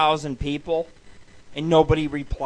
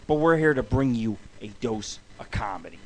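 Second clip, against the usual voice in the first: low, soft, breathy aside; whispered twist on the show intro; 7 semitones lower, 6 dB quieter than usual. Shown as a demonstration. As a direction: lean in and whisper it to the listener.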